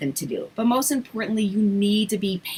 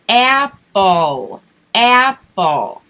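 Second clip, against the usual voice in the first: "apple, apple" said slowly and louder, each one spaced; slow drawn out; 7 dB louder, much slower than usual. Shown as a demonstration. Teacher contrasting with a quick drill, slow and loud. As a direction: stretch the word out instead of rushing it. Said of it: "Rather than".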